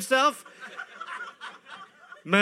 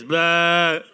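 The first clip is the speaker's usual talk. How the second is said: loud silly blah; 3 semitones lower, 6 dB louder than usual. Pretend. Mock doctor exam.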